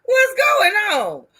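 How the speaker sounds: high, loud hollered welcome; excited greeting. Real, not acted. Host welcomes a Southern caller, excited greeting.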